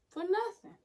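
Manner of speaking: "nothing" jumps way up, rising; high, rising cry of frustration; exasperated squeak. Real, not acted.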